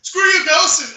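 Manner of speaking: high, loud imitated shout; mock yell. Retelling Korg trying to drop kick a hologram, mock yell. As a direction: shout it as a comic taunt.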